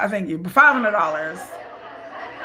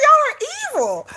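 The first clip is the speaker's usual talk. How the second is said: shrill-high mock outrage, pitched way up; high exasperated accusation. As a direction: squeal it like you're betrayed. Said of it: "I was like".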